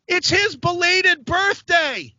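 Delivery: imitated high yell, drawn out; Kelly. Friend outing him to the comedian, mock yell. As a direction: yell it out high and slow, mocking. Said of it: "Kelly yells out".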